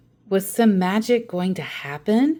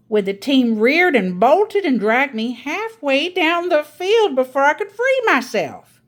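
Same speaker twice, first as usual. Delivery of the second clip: higher, louder grandma telling a grand tale; a grandmother topping her sister's story; Grandma's storytelling voice; 7 semitones higher, 5 dB louder than usual. Acted.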